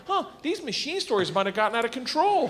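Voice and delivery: high-pitched wondering to oneself; dawning realisation. Retold or imitated. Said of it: "I'm twenty-two years old thinking".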